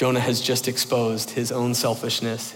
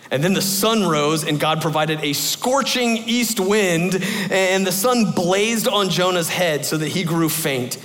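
raised, slow, storytelling Bible read; dramatic scripture reading; 5 semitones higher than usual. Acted. Preacher reading Jonah to the class, dramatic storytelling read.

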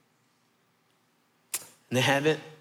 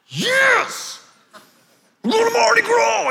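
put-on high, loud kid cheering "Yes! We're going to Mardi Gras!"; thrilled kid shout; 5 dB louder than usual. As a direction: shout it like a kid who just heard the best news. Kids thrilled about the arcade.

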